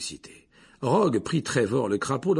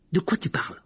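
angry question; 8 semitones higher than usual. Acted. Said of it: "dit Harry avec colère".

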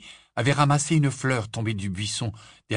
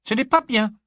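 high, louder prim disapproval; prim girl disapproving; 14 semitones higher, 7 dB louder than usual. Acted. Big sister disapproves of the trick, high and prim. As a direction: it isn't proper, sniffy.

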